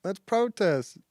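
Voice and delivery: high, loud, bubbly mockery; mock cheerful protester. Mocking protesters who cheer about dancing, in a high loud voice. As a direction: chirp it like a giddy activist.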